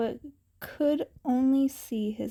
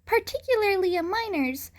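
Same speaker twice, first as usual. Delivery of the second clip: put-on raised, stern young voice; hero voice, scolding thugs; 8 semitones higher than usual. A narrator's reading. Young hero warns thugs, raised and stern. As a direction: tell them off from on high. Thug threatening boy.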